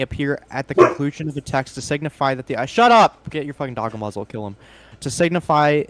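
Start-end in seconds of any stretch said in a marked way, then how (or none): 2.7-3.3 sudden loud snap at the dog; shouting. Real, not acted. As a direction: yell to silence the dog. Yelling at barking dog.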